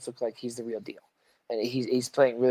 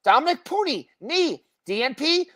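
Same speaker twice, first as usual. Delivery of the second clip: high-pitched, emphatic list reading; injury roll call; 9 semitones higher than usual. Acted. Host reeling off the injury report to co-host, emphatic roll call. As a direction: punch each player's name high, let the status drop.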